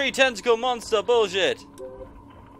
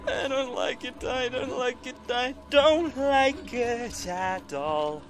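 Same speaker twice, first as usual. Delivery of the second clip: scared whimper; 4 semitones higher, 5 dB quieter, slower than usual. Real, not acted. Scared whimper during game.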